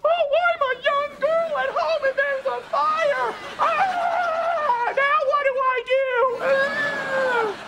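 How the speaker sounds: very high mock-wailing girl voice; panicky young girl. Man showing what not to do in a fire, mock girlish wail. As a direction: imitate a helpless girl in a squealing, drawn-out panic. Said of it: "You don't just go".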